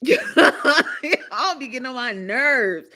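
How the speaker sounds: slow, laughing through the words. Real, not acted. Host teasing late viewers, laughing. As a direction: giggle while scolding.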